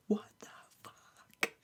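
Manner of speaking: shocked whispered curse. Retold or imitated. Alone in the restaurant bathroom, stunned whisper. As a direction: hiss it under your breath in disbelief. Reacting to scripture on toilet.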